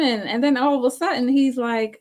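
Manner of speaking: ordinary talk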